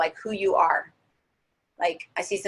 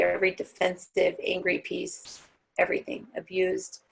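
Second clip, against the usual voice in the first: guided meditation. Acted. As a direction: ease the class down into their hearts.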